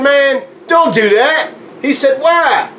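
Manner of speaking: high, pleading mock alarm; alarmed retold exchange. Acted exchange with highway helper.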